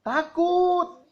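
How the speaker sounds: a light, very high squeal of fright; mock scared zombie. Child asked to do a zombie, squeals in mock fright. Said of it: "Zombie gimana, zombie?".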